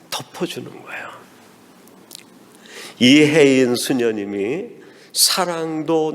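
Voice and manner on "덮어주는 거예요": hushed, unvoiced key line; whispered tender conclusion. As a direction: whisper it like a secret of grace. Hushed key point in sermon.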